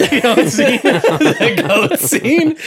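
helpless high-pitched laughter through the words; cracking up. Real, not acted. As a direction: barely get it out for laughing, loud and high. Recalling a hilarious movie scene.

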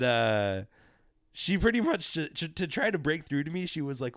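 ordinary delivery

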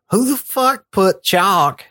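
high, slow, disgusted; disgusted girlfriend. Retold or imitated. Girlfriend finding grit in the sink, slow high disgust. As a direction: drag it out, grossed out and high.

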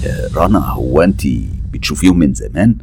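forced calm, low and soft; holding back emotion. Acted. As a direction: keep the lid on your alarm. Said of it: "وأنا بحاول أسيطر على انفعالاتي".

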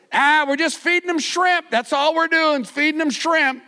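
high, loud breezy brush-off; casual fib. Retold or imitated. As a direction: shrug off the strangers. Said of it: "And he goes".

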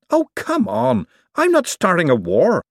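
high-pitched argumentative outburst; indignant protest. A narrator's reading. Acted quarrel over a contract.

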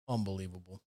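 low, hushed disbelief; disgusted officer. Retold or imitated. Storyteller voicing officer who finds the one hitter, quietly disgusted. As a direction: say it low and quiet, as an unimpressed cop.

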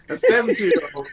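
reading through laughter; can't hold the giggles back; laughing. Real, not acted. Reading news, cracking up.

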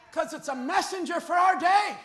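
loud, rising preacher's cry to the flock; fiery preaching. Acted. Pastor pleading with the congregation, loud fiery preaching. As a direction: preach it out, pushing hard on every phrase.

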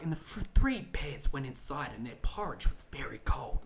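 soft whispered storybook read; bedtime story voice. Retold or imitated. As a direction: hush it like reading to a sleepy child. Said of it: "be like".

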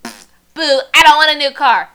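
high, loud whiny Bella; mock whine. Friend retelling Bella refusing Edward's gift, loud mock whine. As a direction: ham up a bratty refusal. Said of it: "she's like".

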